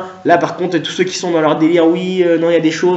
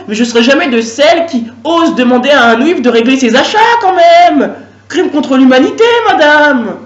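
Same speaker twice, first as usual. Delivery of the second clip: indignant cashier; 4 semitones higher than usual. Acted. Cashier lectures customer indignantly.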